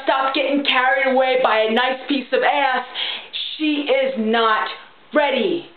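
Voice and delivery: ordinary talk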